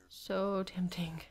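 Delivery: slow whispered temptation. Real, not acted. Tempted to die.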